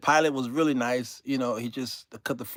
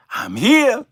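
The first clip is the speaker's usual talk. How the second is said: higher, louder devil cry; mock devil announcing; 8 semitones higher, 9 dB louder than usual. The devil jumping out of a yawning passenger, loud mock call.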